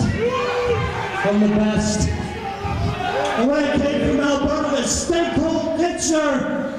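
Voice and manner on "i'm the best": very slow, high, stretched boast; drawn out. Acted. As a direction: drag out every word, soaring up.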